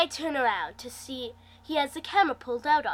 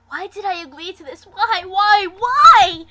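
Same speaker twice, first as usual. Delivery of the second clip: rising panicked cries of why, getting louder and higher; 7 semitones higher, 14 dB louder than usual. Acted. Narrator dreading the drop, panicked rising cries. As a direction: fear building as the coaster tips over.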